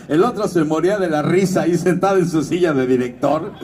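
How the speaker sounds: usual speaking voice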